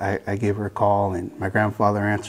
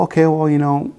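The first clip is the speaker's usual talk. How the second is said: higher, louder brush-off; dismissive retort; 6 semitones higher, 7 dB louder than usual. Retold or imitated. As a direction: wave her off, half mocking. His dismissive retort to grandmother.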